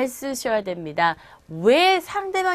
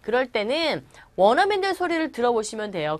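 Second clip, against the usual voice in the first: ordinary delivery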